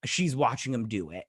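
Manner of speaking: ordinary talk, nothing marked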